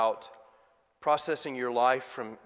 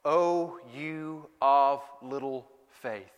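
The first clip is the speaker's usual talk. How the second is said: slow solemn rebuke; 3 dB louder, slower than usual. Acted. Jesus's words, slow.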